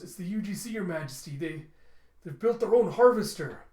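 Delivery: alarmed report. Acted. Guard telling his majesty about the enemy, alarmed. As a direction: blurt out the bad news, acted.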